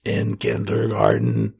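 slow, low chain-smoker rasp; raspy smoker ad voice. Acted. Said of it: "one of those commercials where it's like".

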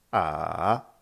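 A dipping low then rising, loud; "A" in a V-shaped contour; third tone. Shown as a demonstration. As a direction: dip "A" down and climb longer. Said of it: "Avec les quatre tons".

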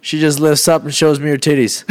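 usual speaking voice

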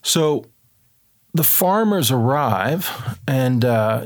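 ordinary delivery

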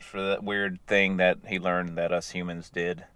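ordinary talk, nothing marked

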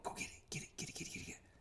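rapid whispered urging to a dog; excited whisper. Retold or imitated. Trainer whispering to get his dog retrieving, eager whisper. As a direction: hiss it fast and eager to fire the dog up. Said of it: "because of my tone".

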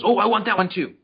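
high, loud pup demanding the toy; excited little-dog voice, high and insistent; eager little dog. Acted. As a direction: burst in as the greedy little dog. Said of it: "then the little one goes".

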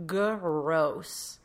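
drawn-out, grossed-out groan; mild mock disgust. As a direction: say it with a wrinkled nose. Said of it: "I'd be like".

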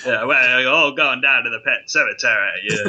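deep hammed-up film impression; Pet Sematary guy. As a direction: mimic the old neighbour's accent, pitched low. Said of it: "a lot like the guy from".

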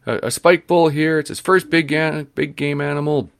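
high, loud excited call; old man calling his wife over, excited. Retold or imitated. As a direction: shout the news across to his wife.

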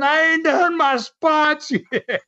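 very high, slow mock holler; retold screaming. Son imitating dad screaming in a ring full of spectators, high mock yell. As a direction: stretch out dad's stubborn yell, imitated without real volume. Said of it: "And he's still screaming".

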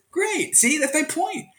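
teacher explains his point to his student, excited and high. Retold or imitated. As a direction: make your point with delight. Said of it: "And I said".

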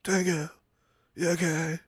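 soft, weakened call to a partner; hushed mock-sad concern; mock sadness. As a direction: ask quietly, as if drained by sadness. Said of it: "shocked and into sadness".

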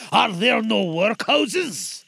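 slow, loud cutting spirit. Acted. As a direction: throw his words back slowly. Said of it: "said the spirit".

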